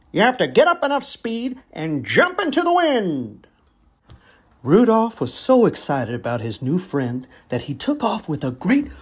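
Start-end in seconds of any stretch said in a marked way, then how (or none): 0.1-3.5 put-on rally the young fawns; coach voice.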